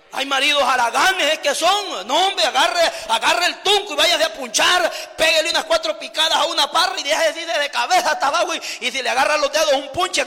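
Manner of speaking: loud, rousing sermon, scolding lazy husbands; fiery preaching. Acted. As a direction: preach at full force to the church. Preaching at full force. (predicación enérgica y a gritos)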